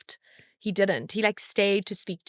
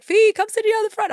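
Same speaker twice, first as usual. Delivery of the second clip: 1 calling out; 13 semitones higher, 7 dB louder than usual. Retold or imitated. Team calling her to front.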